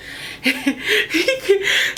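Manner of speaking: high wheezing laugh. Real, not acted. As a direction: break into laughter.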